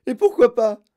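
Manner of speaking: plus aigu et fort; hurlé. Acted. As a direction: hurler une question. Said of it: "hurla madame Gloop". (Mme Gloop hurle à Wonka, aigu et fort)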